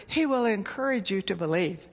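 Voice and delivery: usual speaking voice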